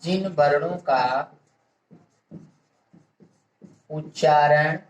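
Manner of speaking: word by word with long pauses; dictation. Real, not acted. Teacher giving students a definition to write down, measured dictation. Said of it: "इसको भी देख लीजिए मित्रों".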